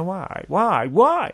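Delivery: climbing, needling whys; mock insistent. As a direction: pester with why, building to a high loud finish. Mock insistent repeated questioning.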